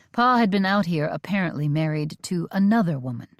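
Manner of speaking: ordinary delivery, nothing marked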